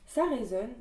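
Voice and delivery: normal talking voice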